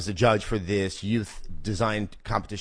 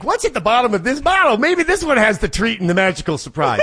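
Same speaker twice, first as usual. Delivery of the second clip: mock hopeful drinker; 10 semitones higher, 6 dB louder than usual. Mock drunk inner voice.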